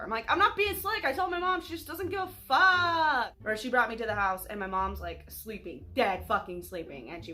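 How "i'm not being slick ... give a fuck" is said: defensive. Retold or imitated. Defending herself to friend's mom.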